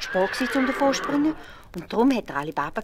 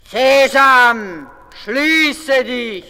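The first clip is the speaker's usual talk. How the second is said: loud, high commanding shout; robber chief's call; 7 semitones higher, 16 dB louder, much slower than usual. A narrator's reading. Robber chief ordering the cliff open, commanding shout. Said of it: "Der Räuberhauptmann hat gerufen".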